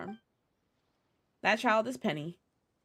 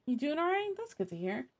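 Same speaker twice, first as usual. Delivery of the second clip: normal talking voice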